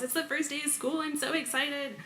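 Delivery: high, soft mock yell of a child; high-pitched, softened kid's mock yell; excited child yelling. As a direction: imitate your little daughter's excitement, high but hushed. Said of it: "and yells".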